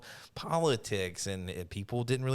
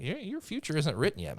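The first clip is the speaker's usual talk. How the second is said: higher, hammed-up wise mentor; a playful send-up of a sage; mock Thrawn mentor; 7 semitones higher than usual. Podcaster mocking Thrawn advising Eli, hammed-up higher mentor. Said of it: "He's like".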